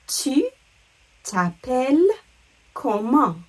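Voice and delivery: "Tu", "t'appelles", "comment" with a pause after each; separated, careful "Tu t'appelles comment"; word by word. Shown as a demonstration. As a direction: break up "Tu t'appelles comment" for learners. Teacher breaks question into words.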